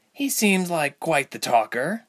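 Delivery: dry teasing drawl; slow, wry teasing jab. A narrator's reading. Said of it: "Ilse teased".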